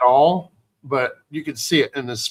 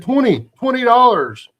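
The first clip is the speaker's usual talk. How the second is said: louder, higher accusing call; 8 semitones higher, 5 dB louder than usual. Retold or imitated. Ron confronts the thief.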